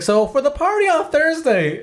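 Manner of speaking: high, singsong imitation of a woman on the phone; mock chipper caller. Quotes the sister's cheerful phone call.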